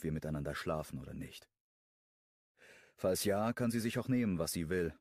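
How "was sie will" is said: ordinary delivery, nothing marked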